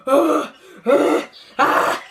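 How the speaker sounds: lightly imitated annoyed groans; mock groan noises. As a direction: ham up her grunts. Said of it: "She's like".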